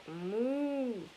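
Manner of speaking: a long gliding moo; lightly voiced cow moo. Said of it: "That's a pretty good cow".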